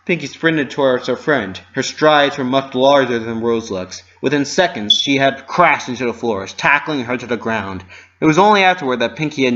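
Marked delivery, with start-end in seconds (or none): none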